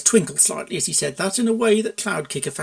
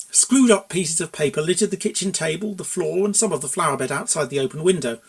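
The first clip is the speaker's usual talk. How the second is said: ordinary delivery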